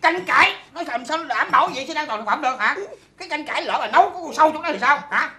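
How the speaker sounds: loud, indignant scolding; angry quarrel. Acted. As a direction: argue hotly at full volume. Married couple's heated quarrel.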